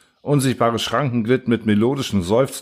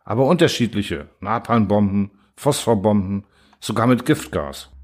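plain reading voice

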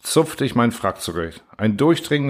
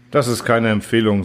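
ordinary delivery

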